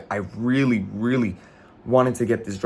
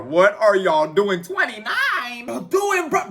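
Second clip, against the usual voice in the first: surprised; 8 semitones higher, slower than usual. Real, not acted. Viewers flooding his store site.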